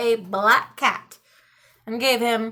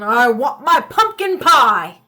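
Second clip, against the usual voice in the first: louder, insistent wail; old man's loud demand; 6 dB louder than usual. A narrator's reading. Old man wails for his pumpkin pie, louder.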